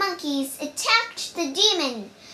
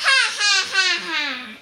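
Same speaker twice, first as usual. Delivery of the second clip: high, loud villain's laugh; mocking laugh; 4 semitones higher, 5 dB louder, faster than usual. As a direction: laugh at the little monkey. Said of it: "He looked at Wukong and laughed".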